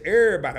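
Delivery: everybody as "Everybody", mostly vowels, loud and drawn; hollered everybody greeting; Felicia's everybody. Retold or imitated. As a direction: call out "Everybody" Felicia style. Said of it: "as my girl Felicia J like to say".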